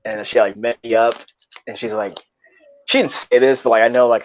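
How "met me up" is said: normal talking voice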